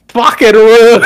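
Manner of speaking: loud, high mock Australian curse; Australian accent. Driver cursing the kangaroo in an Australian accent. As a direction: bark it in a hammed-up Australian accent. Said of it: "Australian accent is great".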